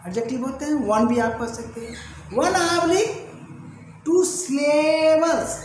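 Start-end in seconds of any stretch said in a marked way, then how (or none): 2.3-5.6 slow emphasis. Shown as a demonstration. Teacher repeats the term slowly.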